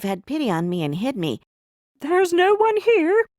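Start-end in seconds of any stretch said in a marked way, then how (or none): 2.0-3.3 higher, louder quick denial; giant's wife telling her husband nobody's here, higher. Acted. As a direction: cover for the boy, innocent. Wife hiding Jack from giant.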